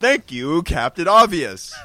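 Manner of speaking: loud, slow sarcasm; sarcastic. Real, not acted. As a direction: drawl it out sarcastically. Sarcastic retort.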